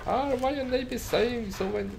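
high, loud Italian merchant voice; a hammed-up Italian trader; Italian accent. Retrying the shopkeeper's line in a high Italian accent. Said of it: "Italia maybe?".

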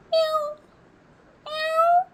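very high kitten meows, the last one rising; cat meows. Shown as a demonstration. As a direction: mew twice for the child. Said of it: "പൂച്ചയുടെ sound".

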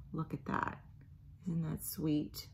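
hushed soft whisper. Acted. Parent showing the sleeping chick, hushed. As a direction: keep it low, baby's sleeping.

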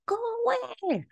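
very high mock-frightened squeal; Ebenezer. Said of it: "And Ebenezer's like".

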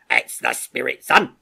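loud, hearty sergeant; approving sergeant. Acted. Sergeant praising a young soldier for volunteering, hearty and loud. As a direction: cheer the lad on loudly.

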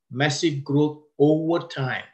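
slow down and press "over time" home; stress on over time. Shown as a demonstration. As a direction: lean hard on "over time", slowed and falling. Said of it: "I like to stress the word over time".

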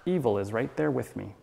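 ordinary talk, nothing marked

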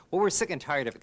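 high, loud exasperated scolding; fed-up scolding. Acted. The sisters refuse Coyote, loud exasperated scolding.

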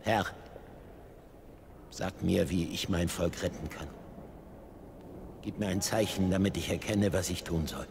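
low, hushed prayer; praying. Acted. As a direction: plead softly with God, with pauses. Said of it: "betete zu Gott". (leise, tief betend)

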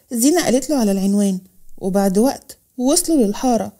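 ordinary delivery

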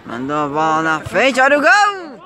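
rising into a shouted goal call; goal commentary. Real, not acted. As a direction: build up and explode on the goal. Narrates own goal play-by-play. (narração de gol empolgada)

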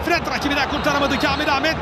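normal talking voice

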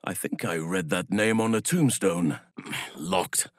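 normal talking voice

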